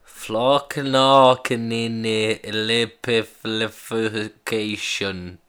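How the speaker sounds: very slow, drawn-out syllable-by-syllable attempt; halting sounding-out. Man copying the pronunciation clips, very slow halting attempt. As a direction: labour through each syllable, stumbling.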